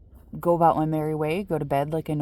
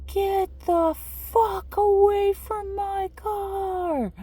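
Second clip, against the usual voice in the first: much higher, slow furious yell; much slower than usual. Retold or imitated. Hanging out the back seat at the knocker, furious yell. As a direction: scream it out the car door to drive them off. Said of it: "I just yelled it again".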